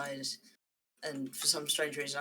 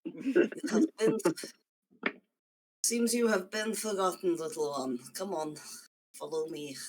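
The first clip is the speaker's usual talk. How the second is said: put-on Russian accent; 3 semitones higher than usual. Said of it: "Your Russian accent has vanished".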